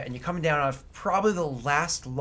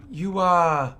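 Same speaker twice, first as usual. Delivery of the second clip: louder, higher, hesitant hail; calling out; 4 semitones higher, 7 dB louder than usual. Acted. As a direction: call across the distance, searching for words. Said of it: "he calls out to you".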